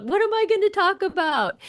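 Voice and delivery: high-pitched retold panic; mock panic. Guest retelling her worry over picking a quote, mock panic. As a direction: playfully act out panicking, voice jumping high. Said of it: "I was, I was panicked".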